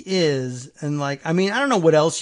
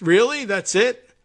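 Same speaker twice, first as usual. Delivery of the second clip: mock disbelief; 6 semitones higher, 5 dB louder than usual. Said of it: "incredulous reporter".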